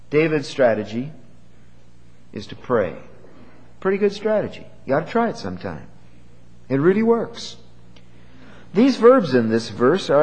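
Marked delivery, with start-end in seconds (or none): none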